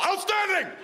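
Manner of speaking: high shouted military praise. Retold or imitated. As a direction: bark it like a proud sergeant.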